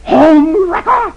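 high, slow furious snarl; wife snarling at Dagwood on the floor. A narrator's reading. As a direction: spit out home wrecker. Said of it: "Missus Dithers snarls".

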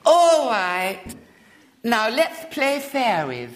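put-on high, slow childish lisp; lisping little girl. A narrator's reading. Little girl asks William's name, lisping high and slow. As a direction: lisp it like a spoilt small girl.

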